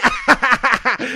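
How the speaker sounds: loud, high cackle; mocking laugh. Real, not acted. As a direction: burst out laughing at the losers. Laughs at the rival fans.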